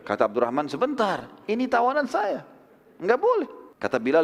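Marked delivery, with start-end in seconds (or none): 0.7-3.5 urgent protest. Acted. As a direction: pitch climbing high in alarm. Abdurrahman protests for his captive.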